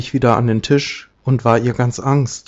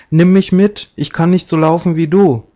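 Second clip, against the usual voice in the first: high, louder plea; frog calling out; 5 semitones higher, 4 dB louder than usual. A narrator's reading. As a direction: call after someone who is leaving you behind. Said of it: "rief der Frosch". (lauter, hoher Ruf des Frosches)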